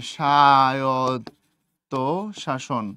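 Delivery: স্বায়ত্ত stretched very slowly, low; drawn-out dictation. Real, not acted. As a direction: draw out each syllable of স্বায়ত্ত as if writing it. Spells out word while writing.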